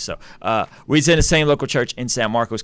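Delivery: normal talking voice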